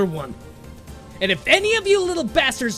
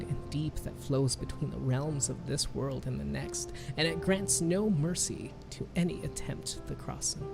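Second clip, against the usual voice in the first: steady her gently but firmly; firm comfort; 5 dB quieter than usual. A narrator's reading. Grandmother urging the girl to keep fighting, soft and firm.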